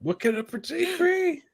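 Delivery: squeaky mocking repeat; mock echo. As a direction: parrot the line back, teasing.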